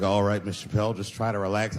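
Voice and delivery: low, even doctor voice; calm gynecologist. Acted. Gynecologist telling husband to relax, low calm. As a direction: keep it low and reassuring.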